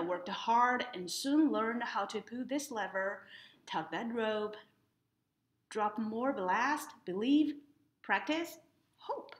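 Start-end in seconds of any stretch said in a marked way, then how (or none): none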